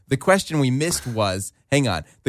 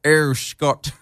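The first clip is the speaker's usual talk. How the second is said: movie-villain impression; Doctor Evil; 2 semitones higher than usual. Shown as a demonstration. Host demonstrating his cue word, Doctor Evil impression. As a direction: slip from the cue sound into the villain voice. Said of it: "it leads me into Doctor Evil".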